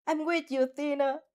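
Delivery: rally her with an eager shout; shouting encouragement. A narrator's reading. Opsy shouts support to Athena inside her head. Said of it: "Opsy shouts in your head".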